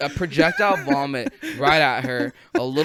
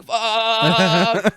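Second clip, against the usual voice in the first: higher, loud, drawn-out curse; mock agonized cry; 7 semitones higher, 6 dB louder than usual. Rider holding on for dear life, loud mock wail. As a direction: wail it like you're hanging on. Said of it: "And I'm just like".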